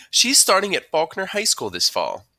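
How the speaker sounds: high, loud mom voice; chatty mom. A narrator's reading. Mom answers her son's teacher, chatty. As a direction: play a mom telling news to a teacher.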